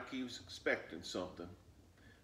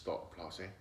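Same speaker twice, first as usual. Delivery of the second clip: plain reading voice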